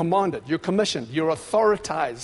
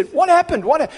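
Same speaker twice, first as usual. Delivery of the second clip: much higher and louder, cut off in amazement; astonished cry; 10 semitones higher, 7 dB louder than usual. Retold or imitated. Healed person finding their lump gone, much higher and louder in astonishment. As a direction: gasp it out as the lump vanishes. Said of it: "they'll look at you and say".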